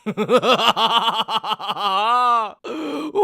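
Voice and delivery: high mocking villain laugh; amused laugh. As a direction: laugh down at her.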